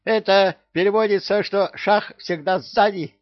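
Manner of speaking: high, squawked announcement. A narrator's reading. Parrot translating the name for all, high yell. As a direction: proclaim it in a shrill parrot yell. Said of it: "завопил ещё громче".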